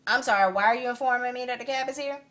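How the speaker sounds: sarcastic mock politeness. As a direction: feign politeness, cut him down. Sarcastic confrontation.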